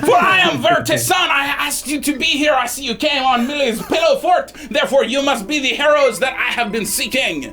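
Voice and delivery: loud, grandly theatrical introduction; Vertisan, booming host. Acted. Game master plays Vertisan.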